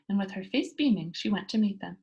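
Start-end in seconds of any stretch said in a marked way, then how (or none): none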